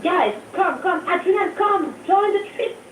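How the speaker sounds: rising eager call. Real, not acted. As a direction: call everyone over eagerly. Inviting everyone on the trip.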